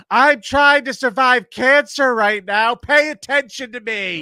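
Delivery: high, slow, full-voiced imitated yell; yelling heckler. Drunk woman demanding the comic's attention, high imitated yelling. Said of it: "the shit she was yelling".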